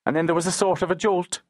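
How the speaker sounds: put-on high, hurried boy's voice; hasty young boy. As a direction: explain it quickly as a young lad. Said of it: "Ned explained hastily".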